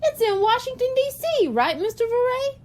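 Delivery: put-on high eager boy's voice; eager kid. A narrator's reading. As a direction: rush to share like a keen boy.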